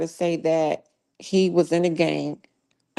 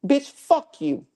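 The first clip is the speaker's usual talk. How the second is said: furious outburst; 8 semitones higher, slower than usual. Real, not acted. Cussing out a chatter.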